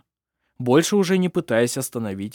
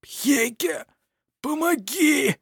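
strained, much higher choking plea; hoarse; 14 semitones higher, much slower than usual. A narrator's reading. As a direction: force it out hoarsely. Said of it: "хрипло выдавил из себя Петер". (хриплый, сдавленный голос)

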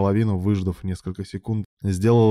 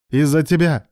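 loud, high accusation; exploding in anger; 8 semitones higher, 7 dB louder than usual. A narrator's reading. Alan blames his lover, exploding in anger. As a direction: blow up and throw blame back. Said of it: "окончательно взорвался Алан".

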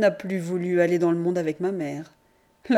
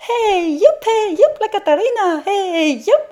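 high, loud festive calls; dance shout; 12 semitones higher, 6 dB louder than usual. A narrator's reading. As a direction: shout it while whirling in a dance. Father dancing, calling out. (cris de danse joyeux)

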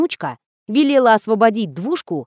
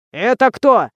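put-on gruff, curt old man; mildly barked question; 4 dB louder, slower than usual. A narrator's reading. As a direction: snap at the caller a little. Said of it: "гаркнул старик".

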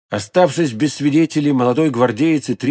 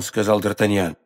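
ordinary delivery, nothing marked

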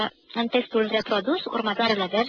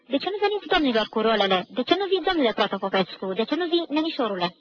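exasperated director; 3 semitones higher than usual. Acted. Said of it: "se plesnește pe frunte".

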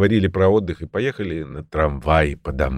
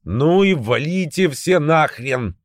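angry outburst; 8 semitones higher than usual. A narrator's reading. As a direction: snap it out in frustration. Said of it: "проговорил я в сердцах".

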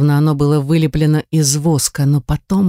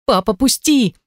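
high, urgent plea to be let go; desperate plea; 7 semitones higher than usual. A narrator's reading. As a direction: cry out to break free. Struggling in her father's grip.

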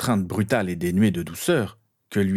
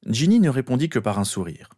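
usual speaking voice

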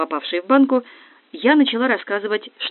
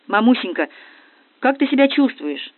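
raised, pitched-up yell; 6 semitones higher, 4 dB louder than usual. A narrator's reading. Маня asks injured mum how she feels, yelling. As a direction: cry it out loudly to mum. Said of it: "заорала Маня".

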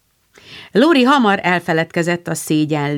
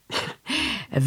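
a quick soft chuckle; amused little laugh; light laugh; 9 semitones higher, 10 dB quieter than usual. Acted. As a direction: laugh at the silly question. Lori amused by the French question.

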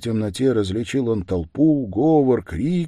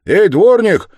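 loud, high holler for the caretaker; calling shout; 13 semitones higher, 14 dB louder than usual. A narrator's reading. Worker calls the yard-keeper on coming out of the gate, shouted. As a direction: call out sharply across a yard. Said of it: "крикнул он".

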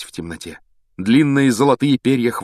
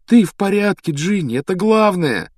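raised, glad exclamation; joyful relief; 10 semitones higher, 3 dB louder than usual. Acted. Brother relieved his sister is safe, joyfully excited. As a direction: reassure her, high with happiness. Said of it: "Рон радостно улыбался".

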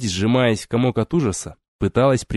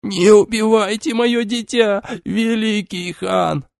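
very high, slow hysterical plea; begging for her child's life; frantic shouting hostage taker; 11 semitones higher, slower than usual. A narrator's reading. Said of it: "истерично закричала женщина".

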